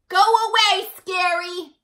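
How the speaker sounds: high, loud brave shout. A narrator's reading. Small person defending Elmore from the scary, shouting. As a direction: chase the monster off. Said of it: "shouted the small person".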